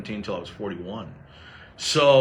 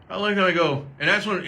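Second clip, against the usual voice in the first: ordinary talk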